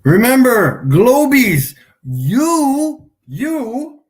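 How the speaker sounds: loud, high, sing-song jeer at the Globies; a loud teasing holler aimed at the chat; mocking call-out. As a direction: rub it in their faces, loudly. Taunting flat earthers on stream.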